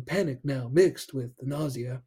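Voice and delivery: lightly voiced halting Shatner read of "Panic now mixed with the nausea."; light Shatner impression; William Shatner. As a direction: pause dramatically between words like Shatner. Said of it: "William Shatner kind of phrasing".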